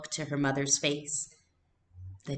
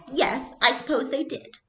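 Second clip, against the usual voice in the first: put-on innocent little girl; 11 semitones higher, 4 dB louder than usual. A narrator's reading. Little sister missing the sarcasm.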